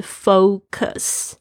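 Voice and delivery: FOCUS drawn out with a long OH, "foe-cus"; correct pronunciation. Shown as a demonstration. Teacher gives learners the true pronunciation, carefully modelled. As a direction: stretch focus into two clear syllables, full OH first. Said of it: "真正的发音是".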